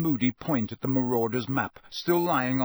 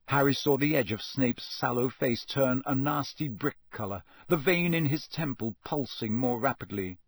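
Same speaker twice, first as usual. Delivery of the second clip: usual speaking voice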